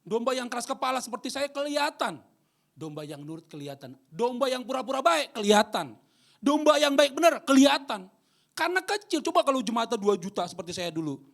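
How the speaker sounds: high, loud pulpit preaching; preaching at full force. Acted. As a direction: drive it home to the congregation. (khotbah berapi-api, tinggi dan keras)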